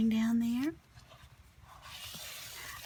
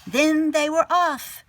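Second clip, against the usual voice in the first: higher, louder excited release; excited burst; 8 semitones higher, 6 dB louder than usual. A narrator's reading. Narrator as the turtles race off, excited and loud. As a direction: let the tension break with joy.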